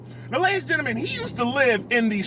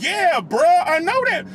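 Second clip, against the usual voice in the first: louder and higher, fed up now; exasperated yell; 6 semitones higher, 4 dB louder than usual. Retold or imitated. Yelling back at the man bragging about his car, exasperated. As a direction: bark it back, sick of hearing it. Repeat answer, losing patience.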